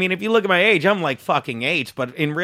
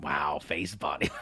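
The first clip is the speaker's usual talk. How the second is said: hushed, stunned whisper; 14 dB quieter, slower than usual. Real, not acted. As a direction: breathe it out in disbelief. Reacts whispering to rating.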